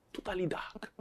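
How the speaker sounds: breathy hushed whisper, unvoiced; a dramatic stage whisper. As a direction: whisper it as if lost in the dark. Evoking total darkness.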